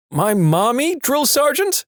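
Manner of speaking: unsure squeak. Retold or imitated. Recruit answering a second drill sergeant, squeaky and unsure. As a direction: answer like you know it's wrong.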